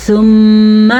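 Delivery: ثم with a long, loud nasal "mm"; ghunna on meem. Shown as a demonstration. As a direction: hum through the doubled meem of ثم. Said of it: "میم کا غنہ ادا کرنا ہے".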